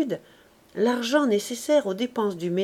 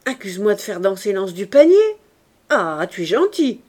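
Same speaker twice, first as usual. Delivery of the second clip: louder, fast, stung and bitter; wounded indignation; 7 dB louder than usual. A narrator's reading. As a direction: snap back like a hurt wife. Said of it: "fut très blessée".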